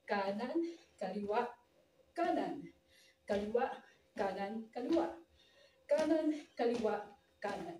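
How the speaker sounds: slow, spaced-out dance count; unhurried step-by-step count; slow practice count. Shown as a demonstration. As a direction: call each step separately, pausing between words. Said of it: "may kabilisan".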